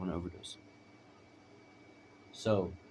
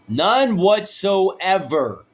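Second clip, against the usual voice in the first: loud, high, drawn-out denial; emphatic outburst; 12 semitones higher, 16 dB louder, much slower than usual. Real, not acted. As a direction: stretch it out big and loud. Emphatic denial to probation officers.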